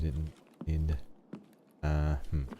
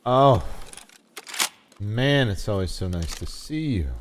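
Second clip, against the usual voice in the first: excited greeting; 5 semitones higher, 6 dB louder than usual. Acted. Greeting a found item warmly.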